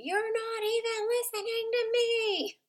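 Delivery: high, put-on kid voice, accusing; hurt kid whining at mom; child complaining. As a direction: voice the child calling a parent out. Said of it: "they will say".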